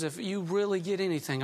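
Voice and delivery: ordinary talk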